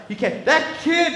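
normal talking voice

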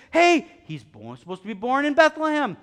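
hammed-up eureka announcement; announced discovery. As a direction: call out the finding at full voice. Acted announcement of scribes' discovery.

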